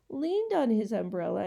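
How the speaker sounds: ordinary delivery, nothing marked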